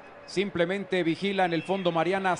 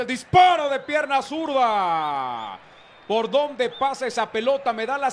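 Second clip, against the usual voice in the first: excited commentary; 4 semitones higher, 5 dB louder than usual. Real, not acted. Live play-by-play shot call.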